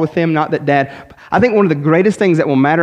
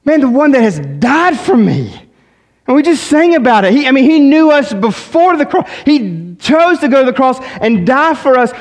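raised, pleading preacher's voice building to a peak; full-force preaching; 9 semitones higher, 3 dB louder than usual. Acted. Pastor pressing the church about sin, preaching at full force. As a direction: drive it home to the congregation.

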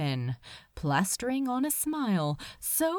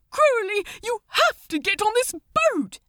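high, loud, fast and desperate; urgent pleading; 13 semitones higher, 5 dB louder, faster than usual. Acted. The angel begging Crowley to listen, high and frantic. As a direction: beg him to listen, near tears.

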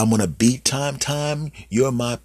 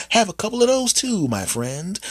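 usual speaking voice

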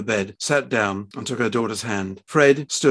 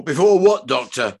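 sharp demand; 3 semitones higher, 7 dB louder than usual. A narrator's reading. Fred cuts the doctor off.

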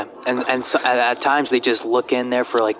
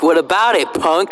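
mock challenge; 4 semitones higher than usual. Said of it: "You're like".

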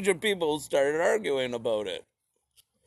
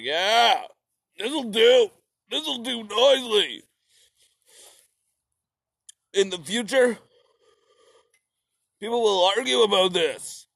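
higher, smug pleased voice; mock Marx; 8 semitones higher, 4 dB louder than usual. Narrator voicing Marx admiring his manifesto, higher and smug. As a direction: sound smug about your work. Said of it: "And he's like".

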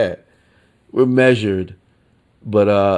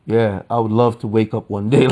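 normal talking voice

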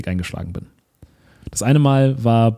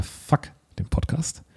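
muttered thought; 5 semitones higher, 9 dB quieter, much slower than usual. Retold or imitated. Retold thought on stage.

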